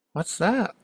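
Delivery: high-pitched, startled question; puzzled question. Retold or imitated. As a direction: ask in wonder, pitch leaping up. Retold Buddha startled by old person.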